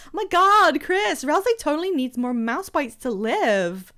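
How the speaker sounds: exaggerated Valley Girl, swooping high; Catty. Telling Chris what Ralsei needs, gushing Valley Girl. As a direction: gush it like an American Valley Girl. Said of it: "That's what Catty sounds like".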